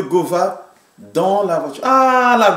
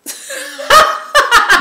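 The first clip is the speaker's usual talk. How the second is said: sudden squealing laugh; high burst of laughter; 4 dB louder than usual. Real, not acted. Wrong guess, bursts out laughing.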